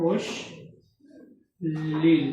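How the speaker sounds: অশ্লীল very slowly, split before "leel"; slow, low dictation of অশ্লীল; pronunciation demo. Shown as a demonstration. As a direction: say অশ্লীল in two stretched parts, with a long pause between. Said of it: "স এর উচ্চারণ হবে দন্ত স".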